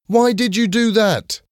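high, indignant yell; angry shout. A narrator's reading. Tony confronts the boy who kicked his ball, angry shout. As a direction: shout it in high-pitched outrage. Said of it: "he shouted".

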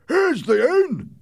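high, strained, drawn-out yell; loud high-voiced henchman; man's shout. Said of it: "one man shouted".

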